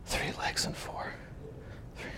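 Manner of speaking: barely audible, voiceless musing to himself; puzzled muttering. Retold or imitated. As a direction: mull it over under your breath, imitating a stumped thinker. Einstein puzzles over the riddle.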